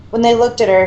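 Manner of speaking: plain reading voice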